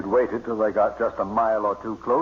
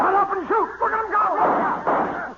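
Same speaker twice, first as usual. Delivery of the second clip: frantic shout. Acted. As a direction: bark it out as the flock flies off. Frantic during duck shooting.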